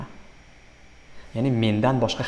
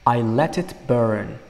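slow, deliberate reading of the lyric; slow model line; slower than usual. Shown as a demonstration. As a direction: let each word land slowly. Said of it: "Demak, men uni, uh, yoqib".

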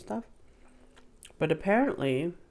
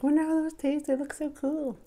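high-pitched wonder; 11 semitones higher, 5 dB louder than usual. Retold or imitated. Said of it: "we were always like".

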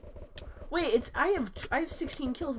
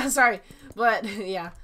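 laughing; 11 dB louder, slower than usual. Real, not acted. Laughing apology.

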